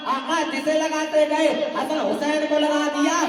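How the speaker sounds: sustained chant-like sermon declamation; rhythmic preaching. Real, not acted. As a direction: build a rhythmic litany of praise, line after line. (लयबद्ध जोशीली तक़रीर)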